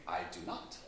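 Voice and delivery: soft, low private thought; small, hushed reply to oneself; meek inner thought. Retold or imitated. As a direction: answer under your breath, low and very quiet. His private thought in the story.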